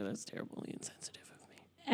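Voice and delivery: breathy whispered joke apology, barely voiced; whispered aside. Real, not acted. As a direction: drop to a sly whisper as if confessing. Mock apology after a joke.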